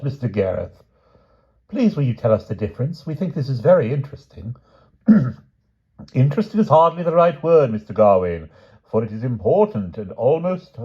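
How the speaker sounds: put-on pompous tutor against cheeky boys; schoolroom squabble.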